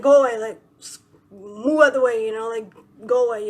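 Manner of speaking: loud, dismissive shooing; retold annoyed order. Handing over the handle and sending him off, annoyed. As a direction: shoo him off forcefully.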